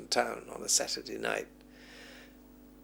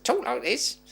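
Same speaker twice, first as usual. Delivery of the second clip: a quick Cockney voice, pitched high; imitating a Cockney accent in passing; 8 semitones higher than usual. Recalling film collector Burt Langdon, a light Cockney accent. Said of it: "a broad Cockney accent".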